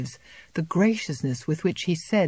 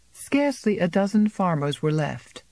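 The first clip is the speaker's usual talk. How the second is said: ordinary delivery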